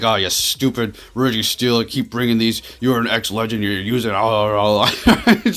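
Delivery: re-enacted grumbling rage, breaking into a laugh; mildly heated, drawn-out complaint, played for laughs; mock raging rant. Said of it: "I'm raging right now".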